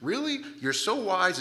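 high, loud incredulous jab; sarcastic disbelief. Acted. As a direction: act astonished at their supposed wisdom. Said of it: "he's, like, saying".

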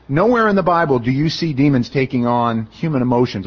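normal talking voice

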